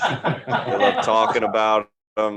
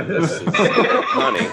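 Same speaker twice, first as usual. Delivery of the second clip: exasperated; 9 semitones higher, 4 dB louder, slower than usual. Retold or imitated. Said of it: "And I go".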